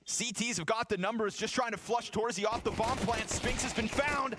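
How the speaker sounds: rising, excited play-by-play call; esports caster. Acted. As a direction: build the call as the clutch unfolds. Live play-by-play casting.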